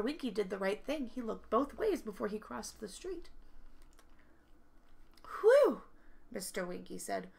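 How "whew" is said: high loud whew of relief; relieved whew. Acted. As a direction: blow out a big whew after a close call. Narrow escape from taxi.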